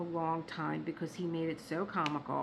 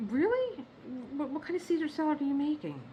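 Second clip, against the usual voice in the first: mock disbelief; 9 semitones higher than usual. Incredulous reply to husband's offer.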